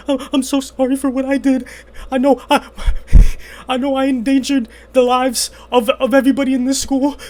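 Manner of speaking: high, loud, tearful plea; tearful apology. Retold or imitated. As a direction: sob out the apology. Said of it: "literally exactly like that".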